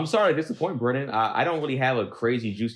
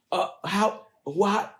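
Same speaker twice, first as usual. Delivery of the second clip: retold stunned stammer, pitched high; halting, high-pitched disbelief; in shock; 10 semitones higher, much slower than usual. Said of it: "I was so in shock".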